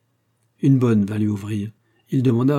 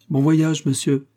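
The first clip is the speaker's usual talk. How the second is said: higher, louder farewell call; 5 semitones higher, 4 dB louder, slower than usual. Acted. Couple to departing visitor, louder farewell. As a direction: shout the goodbye after him. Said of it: "s'écrièrent les deux époux".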